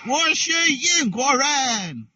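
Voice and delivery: ordinary delivery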